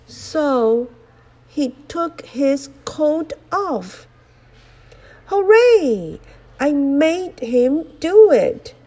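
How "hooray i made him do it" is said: high, loud triumphant cheer. Acted. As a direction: crow with glee over the win. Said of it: "cried the sun".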